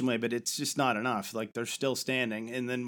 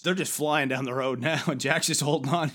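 ordinary talk, nothing marked

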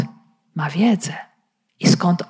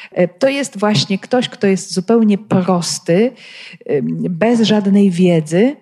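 usual speaking voice

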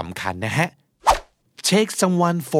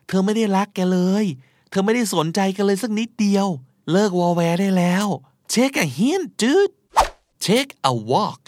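pitched high, climbing to the last word; exasperated telling-off; 5 semitones higher, slower than usual. Acted. Friend telling a suitor to stop pestering her, exasperated. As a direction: drive it home to a clueless pal.

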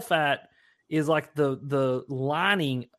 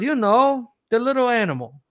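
lightly higher, Haitian host voice; a hint of a friendly host explaining; voiced host; 7 semitones higher, 5 dB louder than usual. Voicing host Rico in story.

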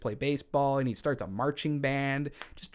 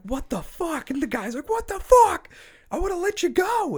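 much higher, loud panicked shriek; high-pitched freaked-out yell; shocked shriek; 8 dB louder than usual. Retold or imitated. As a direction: scream it in outraged disbelief.

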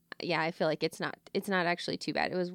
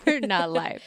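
laughing; 7 dB louder than usual. Real, not acted. Laughing at podcast joke.

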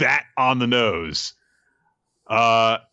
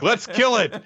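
high acted rallying call; lightly hammed-up eager cry; mock battle cry; 12 semitones higher than usual. Dora wants to kill the creature, mock eager cry. Acting Dora's eager line.

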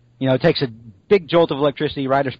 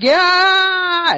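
mock villain yell; 5 dB louder than usual. Villain flung off screaming.

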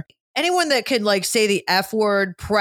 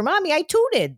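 put-on higher, cute small-boy voice; little child voice; 8 semitones higher than usual. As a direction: imitate her toddler son, innocent. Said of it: "he used to be like".

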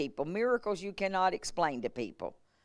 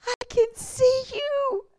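very high, slow cry, building louder; tearful joyful cry; 11 dB louder, much slower than usual. Retold or imitated. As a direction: imitate a woman crying out in amazement. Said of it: "she started crying big old tears".

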